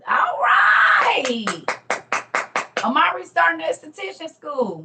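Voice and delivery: high, loud, drawn-out whoop of congratulations; excited cheer. Real, not acted. Host celebrating a viewer starting school, excited cheer.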